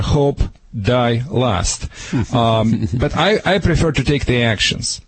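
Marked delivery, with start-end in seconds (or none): 0.0-1.9 Russian saying. Shown as a demonstration. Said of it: "Like we say in Russia".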